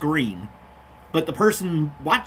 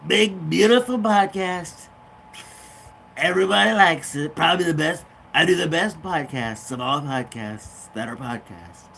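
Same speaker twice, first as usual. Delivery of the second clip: Trump impression. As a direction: boast like Trump, stretching the words.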